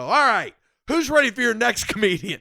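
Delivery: high, loud forced hype; stage emcee. Retold or imitated. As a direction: plow on, announcing the next act. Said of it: "And I go".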